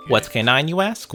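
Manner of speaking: ordinary talk, nothing marked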